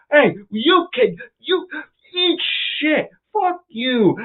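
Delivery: high, slow, stretched-out insult; mock angry driver. The driver telling him off, high slow mock insult. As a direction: drag out the insult like a sore loser.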